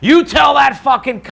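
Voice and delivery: high, loud enraged shout; furious outburst. Retold or imitated. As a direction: explode with rage at the news. Seagal's enraged reaction acted.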